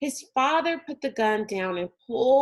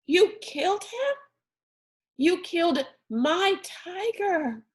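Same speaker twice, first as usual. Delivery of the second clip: shocked outrage; 9 semitones higher than usual. A narrator's reading. Rob confronting his father over the dead tiger, high and outraged. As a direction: hurl it at him in horror.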